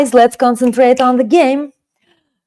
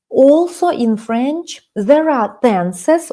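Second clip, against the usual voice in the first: slow explaining; 4 semitones higher, slower than usual. Shown as a demonstration. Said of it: "teacher explaining slowly".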